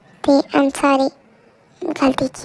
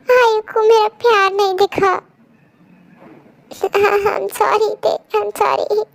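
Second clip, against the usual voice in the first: high, tearful apology, breaking up; crying out his ruined dream; sobbing; 6 semitones higher than usual. Acted. Husband sobbing his apology to his wife. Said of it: "रोइए मत".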